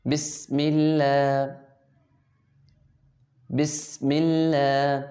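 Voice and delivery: slow "بسم" with a long hissing, whistled s; whistling seen. Shown as a demonstration. Teacher shows pupils the letter seen, whistling s in "بسم". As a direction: hold the whistle on the س of "بسم اللہ". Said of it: "سیٹی کی آواز نکالیں گے".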